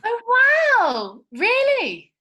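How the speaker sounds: high, loud gasp of wonder; impressed surprise. Acted. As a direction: squeal at the news. Impressed by sports car.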